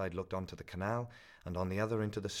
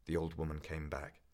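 ordinary delivery

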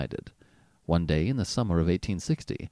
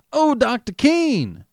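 slow, loud, high drawn-out call; 14 semitones higher, 7 dB louder, slower than usual. A narrator's reading. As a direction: stretch it out to reach him. Father calls to approaching doctor.

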